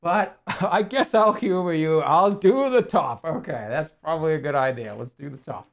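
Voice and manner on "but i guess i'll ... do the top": slow, loud, lordly humoring; mock condescending. Streamer humoring chat's advice, mock lofty. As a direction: grant it like a lofty favor. Teasing a chat comment.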